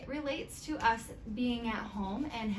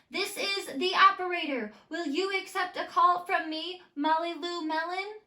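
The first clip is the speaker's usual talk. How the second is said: higher, louder mock operator voice; pretend operator; 9 semitones higher, 7 dB louder than usual. Molly pretending to be the operator calling Gertie, higher. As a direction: play a cheery telephone operator.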